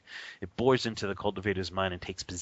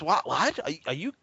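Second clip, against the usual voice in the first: shocked disbelief; 6 semitones higher than usual. Retold or imitated. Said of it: "And he flipped out".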